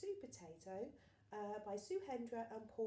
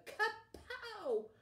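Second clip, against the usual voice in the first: high, loud punch sound; comic-book kapow; 13 semitones higher, 7 dB louder than usual. A narrator's reading.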